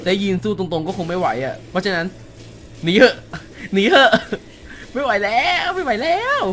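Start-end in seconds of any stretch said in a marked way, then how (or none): 2.8-4.1 urgent yell. Real, not acted. Gamer yelling at partner to flee the huge monster, urgent. Fleeing giant boss. 4.9-6.4 high, strained cry; panicked. Real, not acted. Gamer panicking as the boss closes in, high and frantic.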